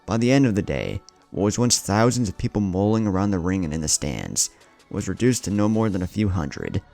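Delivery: ordinary delivery, nothing marked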